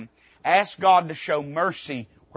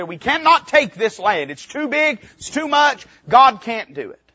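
higher, anxious voice of the spies; fearful spies; 5 semitones higher, 5 dB louder than usual. Retold or imitated. Preacher voicing the ten spies' report, higher and fearful. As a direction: voice the doubting spies' panic.

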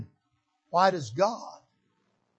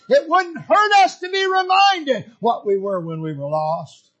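loud, driving pulpit delivery; fervent preaching; 5 semitones higher, 7 dB louder than usual. Acted. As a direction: preach it with mounting fervour to the congregation. Preacher builds to full force.

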